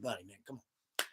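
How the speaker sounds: very soft, low plea; retold under-the-breath appeal; hushed aside. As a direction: act out quietly nudging a friend to drop it. Privately pleading with friend at counter.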